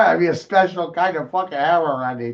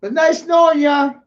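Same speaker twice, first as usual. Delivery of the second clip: mock farewell shout; 11 semitones higher, 4 dB louder than usual. Real, not acted. Shouting goodbye to the bees, mock farewell.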